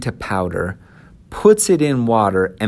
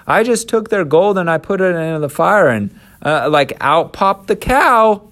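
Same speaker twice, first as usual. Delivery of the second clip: high, hurried lame excuse; whining Aaron; 8 semitones higher, 4 dB louder than usual. Acted. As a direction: blame everyone else, pitched up. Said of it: "Aaron is saying".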